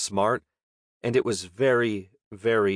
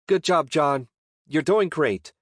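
higher, louder cheer; calling encouragement; 7 semitones higher, 4 dB louder than usual. Acted. Chessa cheers on her friend after his punch, calling high and loud. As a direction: cheer him on from across the room.

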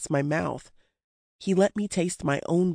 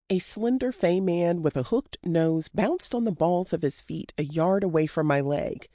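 usual speaking voice

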